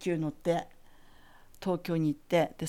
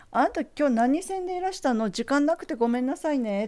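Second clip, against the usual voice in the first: higher, lilting polite lady; teacher's voice; 7 semitones higher, 4 dB louder than usual. Retold or imitated. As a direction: play the refined teacher asking kindly. Teacher asks which train line.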